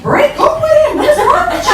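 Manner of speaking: high, loud imitation of a cousin; acted-out shrill scolding; exasperated shout. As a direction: yell some sense into them. Cousin's alarmed advice, acted out.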